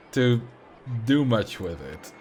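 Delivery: usual speaking voice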